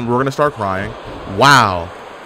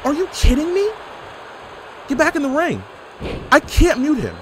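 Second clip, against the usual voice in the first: disbelief; 12 semitones higher than usual. Real, not acted. Streamer unable to mute a player, high incredulous whine. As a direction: pitch way up in exasperated disbelief. Can't mute annoying opponent.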